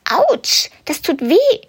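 very high, pained little yelp; a tiny rabbit crying out in pain; rabbit's ouch. Acted. Rabbit hit by parcels. (sehr hohes, schmerzvolles Aufjaulen)

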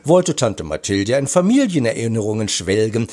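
ordinary delivery, nothing marked

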